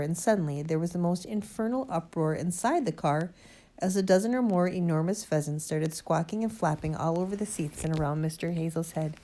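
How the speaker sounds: ordinary delivery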